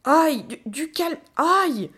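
loud, high yelps broken by words; yelping in pain. Acted. Gorilla dodging the child's kicks, yelping in pain. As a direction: cry ouch while trying to calm them.

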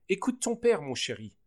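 lightly voiced tearful mother; sobbing. A narrator's reading. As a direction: plead with your son through tears. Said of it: "sanglota maman".

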